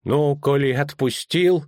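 slow, mildly mournful official; a touch of regret, drawn out; sad. A narrator's reading. Official asking the executioner for his money, sad. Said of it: "грустно сказал траурный чиновник".

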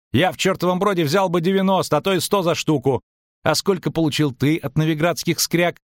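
high-pitched, heated challenge; indignant merchant. A narrator's reading. Merchant challenges the double's sale price, indignant.

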